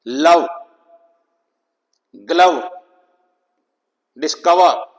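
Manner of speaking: लव, ग्लव, डिस्कवर with a loud stressed "uh" for O; stressed schwa. Shown as a demonstration. Teacher giving letter-V examples, stressed schwa. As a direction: punch the short "uh" in "लव, ग्लव, डिस्कवर". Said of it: "लेकिन stress होगा इस पर".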